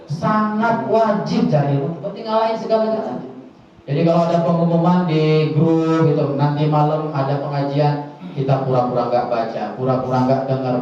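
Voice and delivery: ordinary talk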